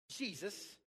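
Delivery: normal talking voice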